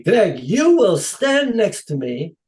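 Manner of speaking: put-on slow, high, loud Dutch-accented decree. Dutch director instructing his helper, Dutch accent. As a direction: proclaim it slowly in a Dutch accent. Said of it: "in his Dutch accent".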